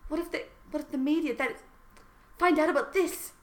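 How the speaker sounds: high, staged fretful question; mock worry. Voices worried heroine in fan fiction.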